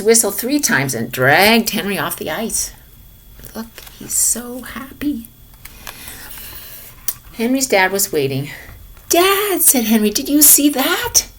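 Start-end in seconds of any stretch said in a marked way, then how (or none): 3.5-5.3 soft tender whisper. Acted. Whispered aside watching Henry. 9.1-11.3 put-on high, eager kid calling out; excited boy. Henry excited to dad.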